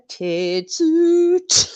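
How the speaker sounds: high, loud Geordie catchphrase; Geordie accent. Acted. Recalling a comic character read aloud, in a Geordie accent. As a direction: shout it out in broad Geordie. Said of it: "read him in a Geordie accent".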